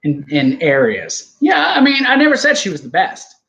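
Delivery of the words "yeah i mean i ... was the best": louder, pitched up high; indignant protest; defensive outburst. Real, not acted. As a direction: protest it hard. Defending view in argument.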